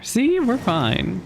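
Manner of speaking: ordinary talk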